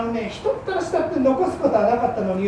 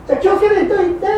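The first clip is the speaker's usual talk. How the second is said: quick send-off; 9 semitones higher, 5 dB louder, much faster than usual. Acted. Wife seeing husband off.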